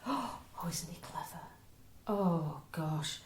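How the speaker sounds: whispered mock awe; hushed admiration. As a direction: gush softly, almost all breath. Joke mother admires boyfriend.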